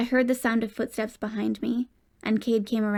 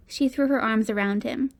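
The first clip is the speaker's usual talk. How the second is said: ordinary delivery, nothing marked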